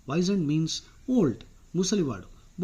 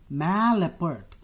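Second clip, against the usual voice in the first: ordinary delivery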